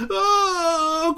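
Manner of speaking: very high startled squeak of a rat; squeaking rat, caught by surprise; frightened squeak. Acted. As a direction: let out a tiny terrified squeak. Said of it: "couina le rat".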